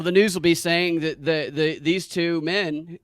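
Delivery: usual speaking voice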